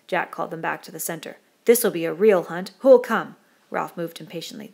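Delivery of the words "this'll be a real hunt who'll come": loud rallying call, rising at the end; rousing challenge. A narrator's reading. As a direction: call the hunters to arms. Jack rallies boys for a hunt.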